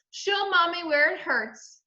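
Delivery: high, slow mommy talk; cooing mother. A narrator's reading. Mother checking her toddler's scrapes, tender cooing.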